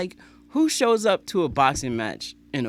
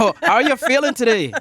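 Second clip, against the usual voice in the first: a terrible put-on Irish lilt, higher and louder; bad Irish accent; 10 semitones higher, 8 dB louder than usual. Admits his Irish is terrible, in a bad Irish accent. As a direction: fumble a jokey Irish accent. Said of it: "my Irish is terrible".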